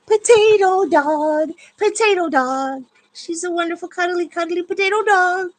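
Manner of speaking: squealing pet talk. Real, not acted. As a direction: gush over the dog. Cooing over her dog.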